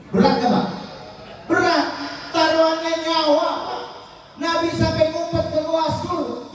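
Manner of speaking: high, fervent sermon voice; preaching at full force. Acted. Preacher urging the congregation to pray, fervent preaching. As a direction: drive the warning home to the congregation.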